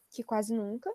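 a quick unvoiced aside; whispered aside. Real, not acted. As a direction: whisper it under your breath as a side comment. Aside while listing heat exceptions.

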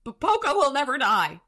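high, loud declaration; acted high-pitched cry; building conviction. A narrator's reading. Butters repeats it, urged on louder.

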